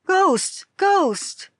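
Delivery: high, loud shout of fright; a man shrieks on seeing the bride; terrified cry. A narrator's reading. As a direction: yell it out in sheer terror. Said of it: "called out".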